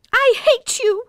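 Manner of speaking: high, loud tearful outburst; tearful cry. A narrator's reading. Emma crying out at Poppy in a tearful high scream. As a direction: scream it through tears. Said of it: "Emma cried, tears streaming".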